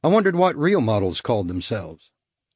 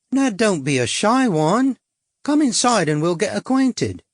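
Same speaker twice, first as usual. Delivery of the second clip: higher, coy cockney come-on; cockney prostitute; 8 semitones higher than usual. A narrator's reading. Suzette luring the stranger, coy cockney come-on. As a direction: tease him in, sweet and brassy.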